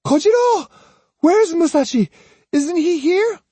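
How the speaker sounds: very high, loud frantic cry; screamed. A narrator's reading. Young girl rushing between swordsmen to find someone, screaming. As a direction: scream the questions urgently. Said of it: "swordsman and screamed".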